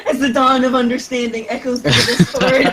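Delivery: laughs through the line; loud laughing narration. Real, not acted.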